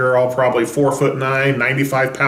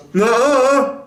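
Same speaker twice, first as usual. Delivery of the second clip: high, dopey mock denial; dim-witted denial; 10 semitones higher, 3 dB louder than usual. Peter denying it to the servant girl, goofy high-pitched blurt. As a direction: blurt it like a caught simpleton. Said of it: "in a very intelligent response".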